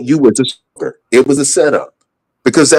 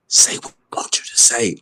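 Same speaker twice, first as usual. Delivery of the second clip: imitated covert whisper, no voice in it; soft, secretive mock whisper; 7 dB quieter than usual. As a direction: whisper the instruction under your breath to someone beside you. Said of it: "whispering to somebody".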